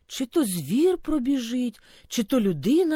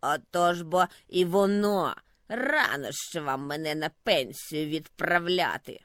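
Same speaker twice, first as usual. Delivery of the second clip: low gloating grunt; gleeful snowdrift; 6 semitones lower than usual. A narrator's reading. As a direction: crow over the frost's return. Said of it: "радісно крекнув". (низьке задоволене кректання)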